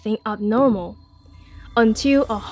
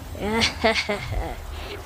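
evil chuckle; 2 semitones lower, 7 dB quieter than usual. A narrator's reading. Wolf plotting alone.

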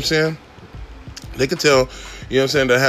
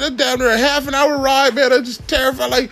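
ordinary delivery

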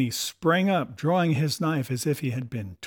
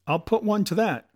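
put-on higher-pitched cry from an old seaman; 6 semitones higher than usual. A narrator's reading. Old seaman Morgan putting in his word against the boy, crying out. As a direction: call it out, pitched up. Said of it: "cried the old mahogany-faced seaman".

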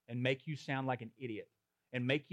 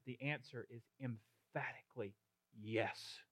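quiet conviction; 2 semitones lower, 9 dB quieter than usual. Real, not acted. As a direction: say it hushed, as a solemn truth. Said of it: "I wanna be very clear".

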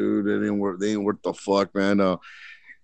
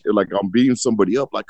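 ordinary talk, nothing marked

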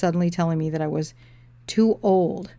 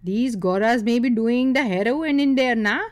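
put-on high, loud accented gossip; mock-confide in an Indian accent, no real whisper; 6 semitones higher, 6 dB louder than usual. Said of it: "he whispered".

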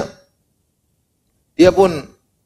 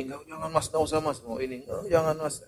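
soft, hushed wife saying no; a hint of a nagging wife refusing; stingy wife; 3 semitones higher, 13 dB quieter than usual. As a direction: quietly forbid the husband's spending. Stingy wife voiced refusing husband.

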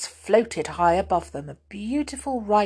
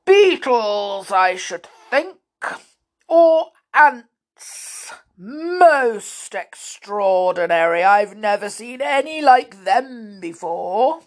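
giant voice; 2 semitones higher, 4 dB louder than usual. Acted. The giant keeps speaking.

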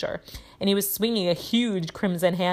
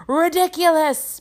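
loud, high bellow; Ron; 11 semitones higher, 6 dB louder than usual. A narrator's reading. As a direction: bellow the spell at full stretch. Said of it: "bellowed Ron".

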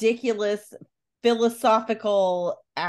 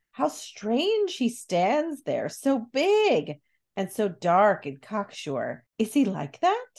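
slow, wondering read of the line; said slowly. Acted. Reading Connie's lines aloud to her co-host, slowed right down. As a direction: draw the words out in awe. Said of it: "she said slowly".

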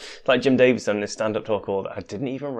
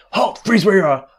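shouted command; 6 semitones higher, 4 dB louder than usual. Acted. Guard stops intruder.

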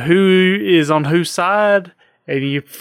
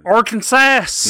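higher, drawn-out mock cheer; playful exclamation; 7 semitones higher, slower than usual. Real, not acted. Host echoes his cohost's show location, with a drawn-out playful cheer. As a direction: stretch it out with playful enthusiasm.

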